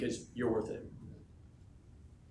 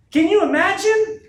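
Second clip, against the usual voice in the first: a slow, booming, much higher shout of the question; 13 dB louder, slower than usual. Real, not acted. Speaker to his sales team, roaring the question. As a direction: roar it to lift the whole room. Hyping crowd about big event.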